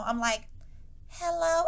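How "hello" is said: high, innocent kiddie hello; thirteen-year-old voice. Retold or imitated. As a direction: put on your littlest child voice. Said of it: "the most kitty fucking thirteen-year-old voice".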